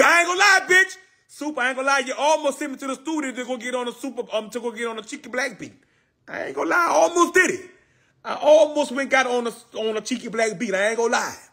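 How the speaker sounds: fast, high-pitched excited rant. Real, not acted. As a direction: squeal it out quickly.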